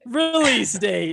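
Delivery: high, loud show-title call-out; intro shout. Pretend. Hosts hamming up their podcast intro, loud title shout. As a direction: belt out the title, drawn out and playful.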